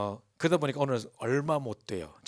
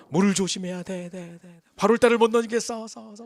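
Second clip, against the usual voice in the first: haunting echo; 8 semitones higher, 9 dB louder than usual. Retold or imitated. The grandfather hears the warning echo in his head as he lies awake. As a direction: replay the monk's words like an echo in a nightmare.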